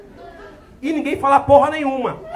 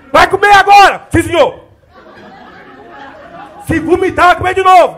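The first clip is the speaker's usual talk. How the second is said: loud, higher drill-sergeant yelling; shouting orders; 4 semitones higher, 8 dB louder than usual. Retold or imitated. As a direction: scream the mealtime orders. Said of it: "tudo gritando".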